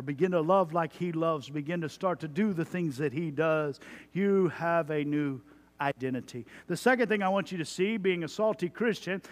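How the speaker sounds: ordinary delivery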